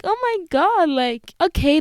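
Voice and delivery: high-pitched delighted realization; retold heart-warmed delight. Podcaster realizing people love the podcast alone, retold high delight. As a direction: go high, as if touched and thrilled. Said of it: "it made my heart so warm".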